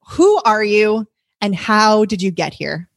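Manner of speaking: ordinary delivery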